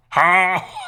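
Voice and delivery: failed Texan-accented cry; Texan accent attempt. Shown as a demonstration. Streamer retries the cry for chat, Texan accent. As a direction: try a drawn-out Texan whoop that doesn't land. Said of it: "that don't work".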